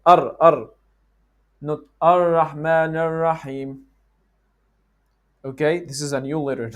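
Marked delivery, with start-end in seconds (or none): 0.1-0.7 'Ar, Ar' with a quick tongue tap, louder; touched R. Shown as a demonstration. Teacher showing the easy fix, crisp tapped R. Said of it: "just touch it". 2.0-3.8 'Ar-Rahman Ar-Rahim' with long untouched Rs; hanging tongue R. Shown as a demonstration. Teacher showing what not to do, hanging R. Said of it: "just touch it".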